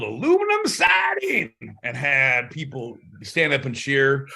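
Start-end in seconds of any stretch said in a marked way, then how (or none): 0.0-1.5 high carnival yell. Pretend. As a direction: shout it out to the crowd. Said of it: "if you've never yelled".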